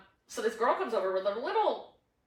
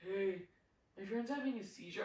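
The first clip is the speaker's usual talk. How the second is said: very soft, lower, casual mumble; nonchalant; 5 semitones lower, 16 dB quieter than usual. Retold or imitated. Imitating the man who strolled over about his friend, nonchalant. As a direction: toss it off quietly, no urgency at all. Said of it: "Like, just so nonchalant".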